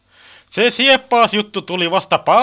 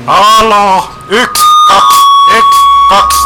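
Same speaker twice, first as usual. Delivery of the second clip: very loud radio check; loud mic test; 9 dB louder than usual. Acted. Testing a new radio phone at close range, loud mic check. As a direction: call into the handset, counting loudly.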